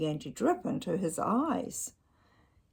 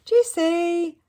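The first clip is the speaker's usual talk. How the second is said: much higher, louder yell; 12 semitones higher, 12 dB louder than usual. Acted. The owner yells at the dog to stop, loud. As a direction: yell the dog's name. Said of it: "yelled its owner".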